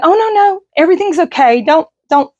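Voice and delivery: high, breezy brush-off; mock reassurance. Mimicking the ex-husband brushing off her panic about the house. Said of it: "he just told me".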